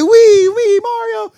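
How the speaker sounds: high, loud Italian cartoon voice; Mario. Immigrant told he sounds like Mario, hammed-up Italian accent. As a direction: squeal it in a mock Italian accent. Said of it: "you sound a lot like Mario".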